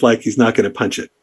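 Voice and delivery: usual speaking voice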